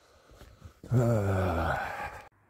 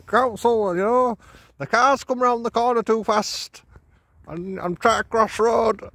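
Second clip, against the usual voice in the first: higher, whiny mock complaint to the council; mock complaining pensioner; 11 semitones higher, 4 dB louder than usual. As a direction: put on a petty grumbler's voice, higher.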